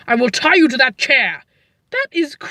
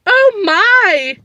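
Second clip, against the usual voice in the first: horrified gasp; 6 semitones higher, 4 dB louder than usual. Acted. Horrified at murder.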